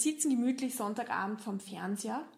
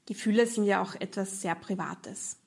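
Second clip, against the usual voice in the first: normal talking voice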